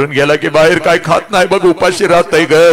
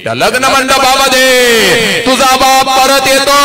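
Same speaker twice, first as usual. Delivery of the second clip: climactic preaching; 7 semitones higher than usual. Acted. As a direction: build to the reveal. (उंच आवाजात प्रवचन)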